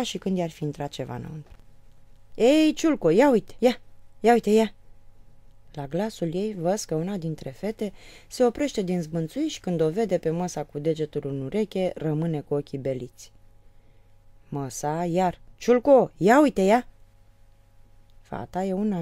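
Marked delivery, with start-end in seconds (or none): 2.3-4.7 put-on higher and louder call to the girl. A narrator's reading. Mother calling her daughter. 15.6-16.9 put-on higher and louder call to the girl. A narrator's reading. Mother calling again.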